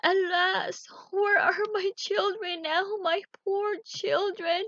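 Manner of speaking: high, loud tearful wail, slowing at the end; a weeping mother calling out for her children; crying wife. Acted. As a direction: wail for your lost children. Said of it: "His wife was in tears now and crying".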